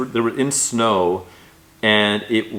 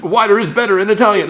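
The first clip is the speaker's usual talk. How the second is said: announcer voice; 11 semitones higher, 6 dB louder than usual. Pretend. Mock commercial announcer line.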